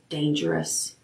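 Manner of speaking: soft, hushed inner warning. A narrator's reading. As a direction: let it slip out under the breath. Inner buzz warning word.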